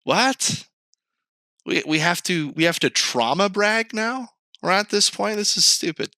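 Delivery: high, disbelieving rant; can't believe what you're hearing; incredulous. Real, not acted. Incredulous reaction.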